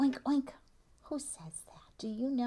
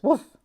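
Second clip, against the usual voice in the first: dog bark; 5 semitones higher, 8 dB louder than usual. Pretend. Librarian voicing dog for kids.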